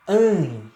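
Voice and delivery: "an" said nasal, through mouth and nose; nasal demo of "an"; closed nasal diphthong. Shown as a demonstration. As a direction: hum "an" through the nose like mãe. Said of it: "ditongo fechado".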